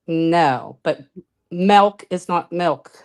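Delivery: ordinary delivery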